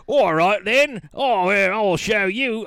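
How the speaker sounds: loud, high Cockney tough guy, drawn out; rough Cockney accent. Retold or imitated. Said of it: "they don't speak with a refined accent".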